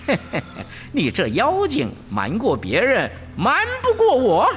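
mocking high Monkey King voice, climbing to a shout; chuckle, then rise to a high, loud taunt; Wukong chuckling. A narrator's reading. Wukong sees through the demon, laughing taunt. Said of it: "悟空就笑着说".

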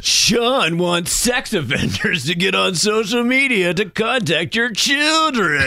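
hammed-up campaign smear; attack ad voice. Mock attack ad on Sean.

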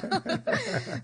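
quick high giggle; bright laugh. Real, not acted. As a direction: burst into a short laugh. Laughs at own excitement.

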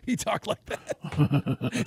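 slow, laughing through the words; cracking up while talking. Real, not acted. Laughing recalling the professor.

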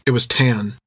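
ordinary delivery

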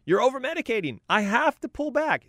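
high-pitched, startled doctor stopping the patient; alarmed doctor. Acted. Doctor to overmedicated patient, high-pitched alarm. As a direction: exclaim it like a shocked physician. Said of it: "your doctor goes".

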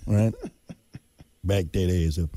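"Back that ass up" muttered low through a laugh. Acted. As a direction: giggle the line out. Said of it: "you can't say back that ass up".

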